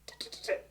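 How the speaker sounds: rapid voiceless tongue clicks; crisp percussive mouth noises; imitated staccato playing. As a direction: mimic choppy keys with ticking sounds. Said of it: "playing like this".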